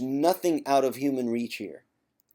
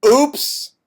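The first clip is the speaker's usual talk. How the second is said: sudden high, loud oops; mock oops; 13 semitones higher, 13 dB louder than usual. Catching himself mid-sermon, a hammed-up loud oops. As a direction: yelp it like you got caught.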